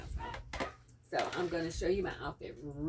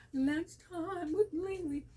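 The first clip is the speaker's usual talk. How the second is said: high, slow, sing-song title call; show-title announcement; 11 semitones higher, 4 dB louder, slower than usual. Acted. Vlogger signing off to viewers, high slow title announcement.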